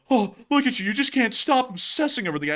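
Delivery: mocking taunt. Mimicking critics who taunt him, high mock sneer. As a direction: jeer like a smug troll. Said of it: "his people will come over here and go".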